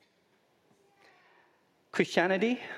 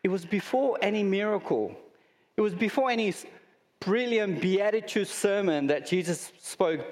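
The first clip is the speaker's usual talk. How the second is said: raised, driving repetition of before; building emphasis; 4 semitones higher than usual. Real, not acted. Preacher stressing God's love came first, rising and emphatic. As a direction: stack each before higher.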